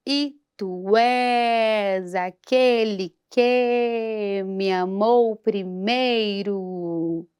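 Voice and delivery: slow, syllable by syllable in pulse, "és" and "amou" marked; spoken syllable division. Shown as a demonstration. Teacher divides the hymn line into syllables. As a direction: count out each syllable of "tu és aquele que me amou primeiro". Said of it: "Vou fazer com você a divisão silábica".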